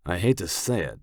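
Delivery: ordinary delivery, nothing marked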